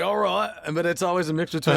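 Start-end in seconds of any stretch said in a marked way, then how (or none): 0.0-0.5 high, loud mock Aussie-English twang; nervous mixed accent. Shown as a demonstration. Guest showing off his nervous accent to the hosts. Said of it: "it's always the nervous accent".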